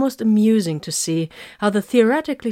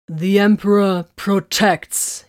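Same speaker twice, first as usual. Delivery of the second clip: slow, louder battle call; 2 semitones higher, 5 dB louder, slower than usual. A narrator's reading. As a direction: call it out slowly. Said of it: "he called".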